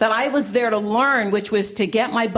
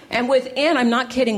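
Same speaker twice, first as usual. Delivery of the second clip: ordinary talk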